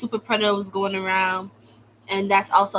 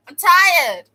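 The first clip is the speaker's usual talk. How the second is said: even higher, loud, breaking plea; more fed up; 9 semitones higher, 9 dB louder than usual. Real, not acted. Venting to viewers again, a higher loud wail. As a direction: push it up into a wail.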